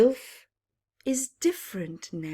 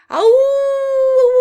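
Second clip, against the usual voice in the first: high loud howling call; long happy wolf howl; 15 dB louder than usual. Acted. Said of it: "wolves howled with joy".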